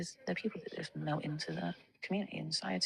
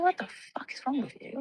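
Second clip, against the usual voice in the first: retold shocked outburst; 9 semitones higher than usual. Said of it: "And I was like".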